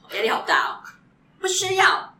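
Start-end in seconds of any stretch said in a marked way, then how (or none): 1.4-2.1 high hammed-up American twang; exaggerated American accent. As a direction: overplay the returnee accent. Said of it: "你有在放大那些精巧的地方". (夸张的美国腔)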